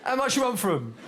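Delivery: lightly voiced cocky lad; young geezer voice. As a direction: bargain like a street dealer. Old man thinks he's young dealer.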